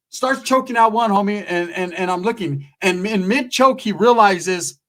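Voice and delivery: ordinary talk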